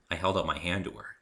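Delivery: usual speaking voice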